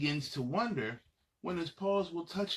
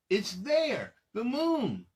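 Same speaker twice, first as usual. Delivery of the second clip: excited; 5 semitones higher, 5 dB louder, slower than usual. Acted. Moon appears ahead.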